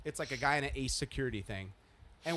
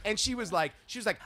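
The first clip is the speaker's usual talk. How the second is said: ordinary delivery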